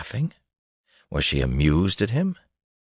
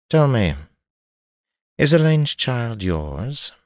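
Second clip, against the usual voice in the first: higher, slow, cool lady; noblewoman voice; 7 semitones higher, slower than usual. Acted. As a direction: voice her like a cold noblewoman. Narrator voices Cheyenne.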